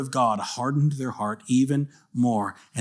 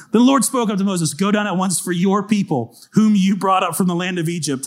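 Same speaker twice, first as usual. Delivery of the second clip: higher, louder Bible reading with ringing emphasis; forceful scripture reading; 7 semitones higher, 6 dB louder than usual. A narrator's reading. Preacher rereading God's command to Moses, raised and forceful. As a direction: declaim the verse with authority.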